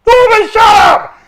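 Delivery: high, loud, slow shout of Norman, shut up; mock prison guard shouting; imitated guard yell. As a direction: bellow it like a fed-up guard. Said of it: "retold guards shouting".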